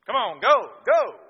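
very loud, high furious yell at the donkey; frustrated shout. Retold or imitated. Balaam yelling at his donkey that won't go, furious shout. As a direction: scream at the donkey to move. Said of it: "Balaam on a donkey... get frustrated".

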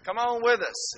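high, slow call across the field. Retold or imitated. Friends calling Dad to the creek, high and loud. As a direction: call him over to come swimming. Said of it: "they would scream out to Dad".

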